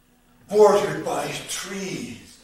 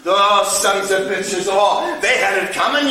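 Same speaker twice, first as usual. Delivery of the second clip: louder, rising bitter scorn; bitter outburst; 3 semitones higher, 7 dB louder than usual. Acted. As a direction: spit it out with contempt. Repeated phrase, louder and higher.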